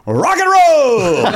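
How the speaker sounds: high, long drawn-out holler; imitated fan yell. Fan yelling out between songs at a concert, imitated yell. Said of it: "that's what you yell out".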